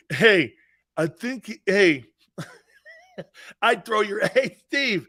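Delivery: words broken up by real laughter; real laughing; laughing while talking. Real, not acted. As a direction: talk through the giggles.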